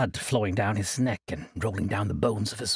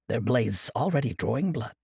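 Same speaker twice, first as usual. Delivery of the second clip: ordinary delivery, nothing marked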